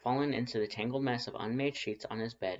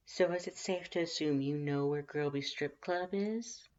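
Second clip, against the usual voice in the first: flirty teasing voice; 6 semitones higher than usual. Acted. Voiced character Lust.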